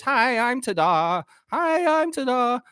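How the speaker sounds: slow, high little mascot voice; cartoon mascot. Ta-da mascot greeting homeowners, slow high cheery voice. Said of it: "Where's the little ta-da dude?".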